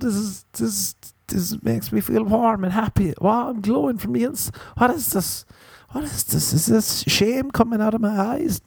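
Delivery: high, hammed-up emotional gushing; overwhelmed with bliss. Cuddler overcome by joy with a girl, gushing very high.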